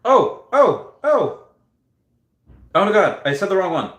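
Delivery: high, loud yelps of dismay; startled high exclamation; alarmed realization. Real, not acted. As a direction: blurt it out as the mistake hits. Realizes own mistake.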